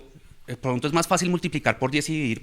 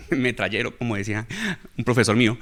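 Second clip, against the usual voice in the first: ordinary talk, nothing marked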